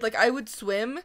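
ordinary talk

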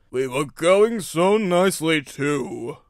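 slow, loud lament; complaining engine. A narrator's reading. Big engine lamenting the spoiled run, slow complaint. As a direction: stretch out the grievance.

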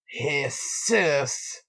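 "Jesus" as a drawn-out "heh-SOOS"; playful name pronunciation. Pretend. Naming who shares a moment with Lafayette, playfully drawn-out "Jesus". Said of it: "I gotta say it like that".

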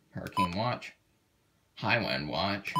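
normal talking voice

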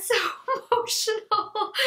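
high laugh through the words; emotional giggle; teary laugh. Real, not acted. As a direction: laugh as the tears come. About to cry again.

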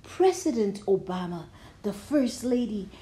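plain reading voice

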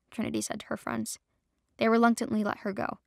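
plain reading voice

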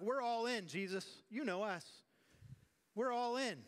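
high, loud, gushing pledge; overeager, sure of themselves; eager disciples. Acted. Disciples pledging loyalty to Jesus, high and eager.

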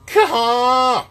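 high, loud goose "Caw"; spit moan. Shown as a demonstration. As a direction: honk it like a goose. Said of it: "and then back up".

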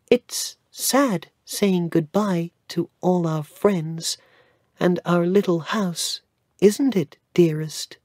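put-on high, gentle child's voice; little boy Cedric. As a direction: speak as a sweet young boy.

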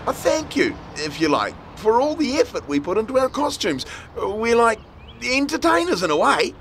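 put-on high young girl voice; girl's voice. A narrator's reading. Robin explains, girl voice.

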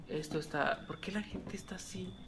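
soft unvoiced whisper. Real, not acted. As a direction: hush it so nobody hears. Susurra escondiéndose del enemigo. (susurro nervioso)

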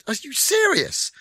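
high, incredulous question; as if you can't believe the offer; amazed disbelief. Retold or imitated. Retelling amazed reaction to offer.

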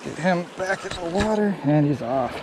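normal talking voice

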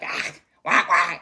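loud put-on crybaby wah wah; hammed-up wailing; mock whining wail. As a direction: fake a whiny sob. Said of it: "very much like".

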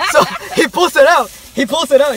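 loud, high and thrilled; excited. Real, not acted. Man thrilled as he retells the clerk pulling out the bottle, loud. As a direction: tell it loud, barely containing the joy.

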